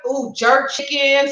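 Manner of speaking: usual speaking voice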